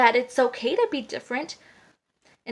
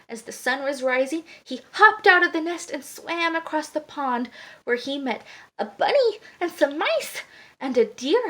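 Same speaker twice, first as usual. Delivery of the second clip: plain reading voice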